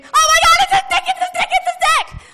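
very high, very loud laughing scream. Retold or imitated. Teens on the bus screaming at the window, laughing hysterically. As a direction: shriek it over and over, half laughing. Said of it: "So we start screaming".

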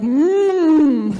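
loud, drawn-out "mmm"; alarmed closed-mouth groan. Retold or imitated. Sister seeing the vomit coming, loud alarmed mmm. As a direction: imitate a long warning moan through closed lips.

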